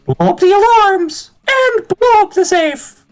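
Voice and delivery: high cartoonish troublemaker voice; squeaky excitable schemer; Damien voice.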